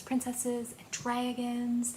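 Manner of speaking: usual speaking voice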